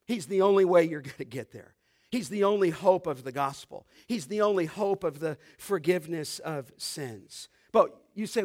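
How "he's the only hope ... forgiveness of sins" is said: normal talking voice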